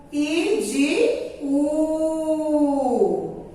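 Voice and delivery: slow, stretched demo of I and U; drawn-out vowel demo. Shown as a demonstration. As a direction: stretch each vowel slowly for the class. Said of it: "Quando estão na sílaba fraca". (pronúncia lenta e alongada de I e U)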